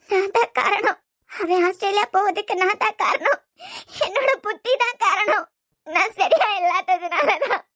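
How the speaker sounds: tearful sobbing. Acted.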